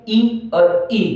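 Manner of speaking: ई first short, then ई drawn out long, slowly; teacher demonstrating ई short vs ई long. Shown as a demonstration. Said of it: "एक को बोलने में समय कम लगेगा".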